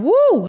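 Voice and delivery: sharp high woo of pain; pained high yelp. Real, not acted. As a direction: yelp through the cramp. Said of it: "Still cramping".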